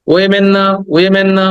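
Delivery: "ওয়েমেন" with e vowels, we-men, then না; the mistaken "ওয়েমেন", emphatically ruled out; wrong pronunciation. Shown as a demonstration. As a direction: reject "ওয়েমেন", voicing it as the mistake. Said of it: "ওমেনও না".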